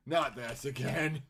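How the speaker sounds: slow, dragged-out dismay; exasperated groan, stretched out; Beethoven. Acted. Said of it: "Beethoven's like".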